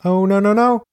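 high, loud shout of protest; acted shout. A narrator's reading. Narrator voices the frightened victim, acted high shout. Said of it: "again shouts".

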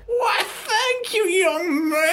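put-on slow, very high old lady; eager granny, drawn out and squeaky; Sherry Ovaltine. Wife telling husband her cruise plans, very high old-lady voice. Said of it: "Should I do a voice?".

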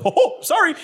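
a mock sorry, breaking into a laugh; laughing apology. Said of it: "And Jarvis goes".

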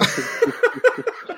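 squealing high laugh. Real, not acted. Laughs at Eternals ranking.

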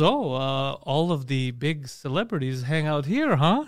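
low, soft and slow imitation; mock awkward guest. Odd guest sizing up Sammy's place, mock awkward. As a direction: draw it out quietly, as if imitating an odd visitor.

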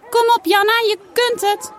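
high loud cheering call. A narrator's reading. Saar cheers Janna on from the pool, high loud call. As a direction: shout encouragement up to her. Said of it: "hoort ze Saar roepen".

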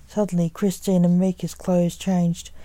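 usual speaking voice

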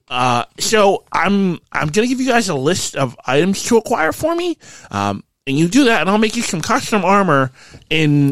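high, animated character voice; Quentin, eager craftsman. Acted. Game master plays Quentin.

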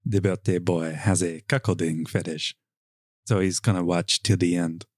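playful put-on French accent. Reading a guest's line in the clown story, French accent. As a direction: read the character with a French accent. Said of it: "Do I wanna go, go French?".

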